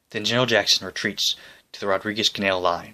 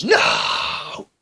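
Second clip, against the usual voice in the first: anguished cry of "No!", much higher; yell. Acted. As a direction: cry out to the sky in despair. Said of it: "throws up his hands and yells".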